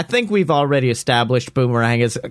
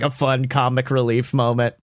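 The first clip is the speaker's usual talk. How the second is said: ordinary talk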